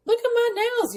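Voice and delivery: high, thrilled "Look at my nails"; excited showing off. Real, not acted. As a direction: show them off with delight. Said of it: "showing off her nails".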